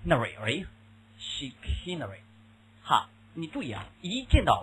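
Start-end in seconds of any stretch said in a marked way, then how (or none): 0.1-2.2 chicanery said slowly and softly; slow drilled pronunciation; slow model. Shown as a demonstration. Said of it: "我们讲过多次啊".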